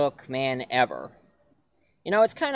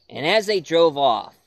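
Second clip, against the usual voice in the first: plain reading voice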